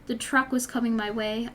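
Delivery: ordinary talk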